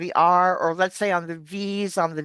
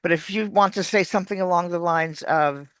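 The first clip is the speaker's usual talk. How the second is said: ordinary talk, nothing marked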